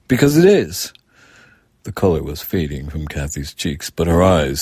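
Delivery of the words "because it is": put-on high, loud child's voice; stubborn little girl insisting; childish insistence. A narrator's reading. Girl defending herself to her mother, stubborn.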